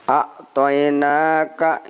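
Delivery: "A'tainaka" slow with the long a stretched. Shown as a demonstration. Teacher models how "A'tainaka" is read. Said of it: "itulah bacanya".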